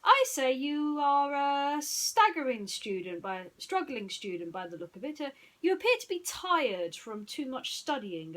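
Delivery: deduce it grandly; detective voice. Acted. Basil deduces aloud.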